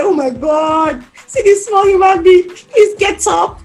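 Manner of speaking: pleading mother. Retold or imitated. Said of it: "you're like".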